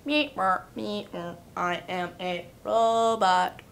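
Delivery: slow, stiff robot monotone; toy robot voice. Shown as a demonstration. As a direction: drone it like a robot. Robot voice demo.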